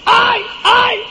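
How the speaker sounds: high, shrill fake cries of alarm; a woman crying out for help, hammed up; Potiphar's wife faking a scream for help; mock scream. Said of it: "ella se puso a gritar".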